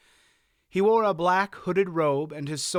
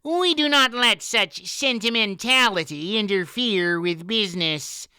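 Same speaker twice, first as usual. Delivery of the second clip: Eilbrig, much higher and louder, nasal; high-pitched nasal voice; 7 semitones higher, 4 dB louder than usual. A narrator's reading. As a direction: pinch it up high through the nose. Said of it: "in his rather high-pitched nasal voice".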